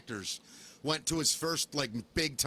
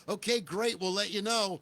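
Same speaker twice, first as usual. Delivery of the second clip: higher-pitched polite brush-off; lightly voiced casting director, pitched up; 5 semitones higher than usual. Casting director dismissing an actor after three lines, politely upbeat. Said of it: "the casting director said".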